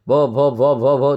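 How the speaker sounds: "भो" repeated over and over in stutters; stammering. Acted. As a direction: stutter on "भो" without reaching the second syllable. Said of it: "फिर हकलाऊंगी".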